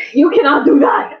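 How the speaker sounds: laughing. Real, not acted. Player laughing at the GM's joke, protesting through laughter. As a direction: protest while cracking up.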